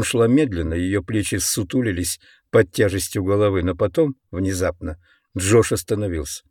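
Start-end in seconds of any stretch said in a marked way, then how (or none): none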